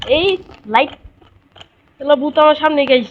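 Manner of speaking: loud, high-pitched game panic; alarmed warning. Pretend. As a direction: call out urgently that danger is here. Ghost right in front of player.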